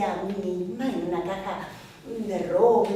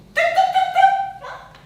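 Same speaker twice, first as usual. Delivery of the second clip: knocking sound; 10 dB louder than usual. Acted. Imitating the knock on wood.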